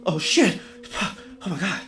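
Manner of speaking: big loud gasped oh, then quick questions; feigned surprise. Retold or imitated. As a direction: act shocked, high and hurried. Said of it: "just to make shit look like I had no idea what was going on".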